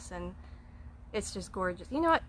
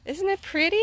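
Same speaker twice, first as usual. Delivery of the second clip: bright high gushing question; excited; 9 semitones higher, 6 dB louder than usual. Real, not acted. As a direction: gush over the view, higher. Showing house on camera.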